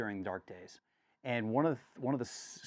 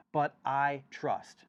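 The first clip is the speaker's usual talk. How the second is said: slow, louder, deliberate; slow declaration; 4 semitones higher, 5 dB louder, much slower than usual. Acted. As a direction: lay down each word slowly.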